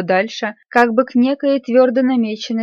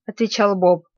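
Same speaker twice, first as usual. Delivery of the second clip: plain reading voice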